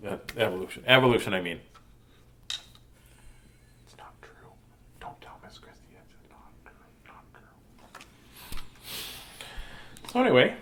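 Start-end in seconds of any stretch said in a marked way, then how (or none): 2.5-7.9 hushed conspiratorial whisper; confessing the made-up science in a whisper. Real, not acted. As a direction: lean in and whisper the secret. Secret aside to the camera.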